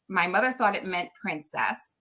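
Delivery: usual speaking voice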